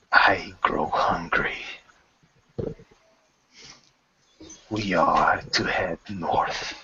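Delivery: hungry, sinister whisper; menacing whisper. Acted. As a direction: breathe the threat. Mistress whispers.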